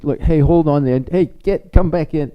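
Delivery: loud, high call across the room. Retold or imitated. Teacher calling the pupil back in, loud and high. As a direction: call the kid back before he goes.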